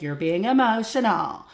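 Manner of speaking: dismissive parent. Retold or imitated. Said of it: "I don't sit there and be like".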